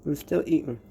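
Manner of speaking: talking while chewing; words round a mouthful of bread; mouth full. Real, not acted. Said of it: "I shouldn't be talking with my mouth full".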